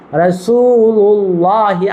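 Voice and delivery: রাসূলুল্লাহি chanted very slowly, drawn out; model pronunciation. Shown as a demonstration. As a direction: stretch রাসূলুল্লাহি slowly with a thick la. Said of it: "কি উচ্চারণ?".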